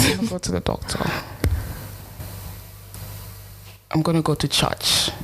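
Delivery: failed attempt at Nigerian accent; bad Nigerian accent. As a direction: badly imitate a Nigerian accent. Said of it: "It's supposed to be Nigerian".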